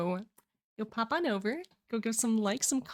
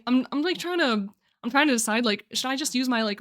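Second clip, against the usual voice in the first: ordinary talk, nothing marked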